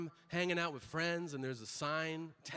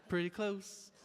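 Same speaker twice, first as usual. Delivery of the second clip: higher-pitched hopeful excuse; sheepish; 4 semitones higher, slower than usual. Retold or imitated. Patient excusing a wrong answer to the clerk, sheepish. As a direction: play down the mistake hopefully.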